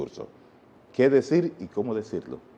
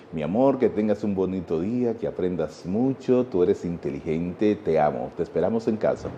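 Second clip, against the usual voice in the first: loving goodbye; 5 semitones lower than usual. Retold or imitated. Father saying goodbye to his daughter at school, low and tender. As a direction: send her off wrapped in love. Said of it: "esas palabras de amor".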